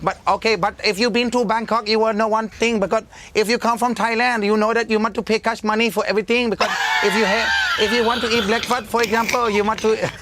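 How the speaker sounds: hammed-up Thai-accented English. Shown as a demonstration. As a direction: imitate a Thai speaker explaining Bangkok.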